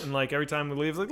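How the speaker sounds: ordinary talk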